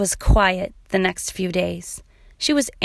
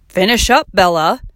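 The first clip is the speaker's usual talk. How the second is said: louder call across the yard; 6 dB louder than usual. A narrator's reading. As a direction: call to your daughter to hurry. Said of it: "called Dad".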